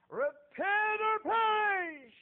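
very high, very loud, drawn-out yell; loud, slow, high call to repent; street preacher's cry. Retold or imitated. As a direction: hurl the warning at the crowd slowly.

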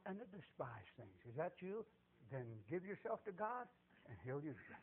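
low, soft preacher's invitation; hushed altar appeal. Real, not acted. Preacher urges listeners to give themselves to God, hushed appeal. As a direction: gently draw the listeners in.

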